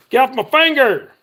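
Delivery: real pained snap at the hook; sudden outburst. Real, not acted. Hook caught in his finger, he yells at it sharply.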